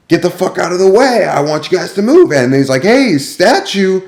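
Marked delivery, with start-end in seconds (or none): 0.1-2.3 angry cook. Retold or imitated. Cook yelling at staff in a small kitchen, angry high bark. 2.8-4.0 high, slow, drawn-out sneer; mocking insult. Rude cook mocking a coworker standing still, slow high sneer. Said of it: "he called me a statue".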